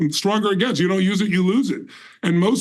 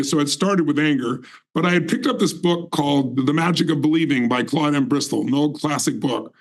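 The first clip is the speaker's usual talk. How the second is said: ordinary talk, nothing marked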